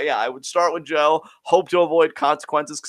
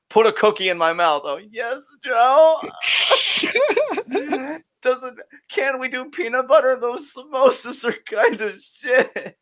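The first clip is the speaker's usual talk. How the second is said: much higher, whiny and cringing; 10 semitones higher than usual. Acted. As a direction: squeal like you're being slapped. Pantomimed slapped by Joe.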